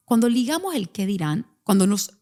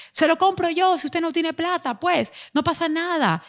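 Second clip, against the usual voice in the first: mock bystander; 7 semitones higher than usual. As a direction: play a smug onlooker offering to pay. Bystanders mocking the mother.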